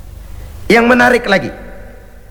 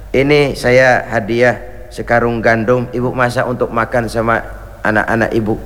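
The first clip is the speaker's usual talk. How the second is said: gentle giving; 9 semitones lower than usual. Retold or imitated. Umar gives the widow a sack of wheat, low and gentle.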